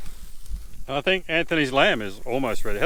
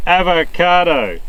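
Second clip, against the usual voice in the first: slow; 4 semitones higher, 4 dB louder, slower than usual. Shown as a demonstration. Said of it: "With the right word".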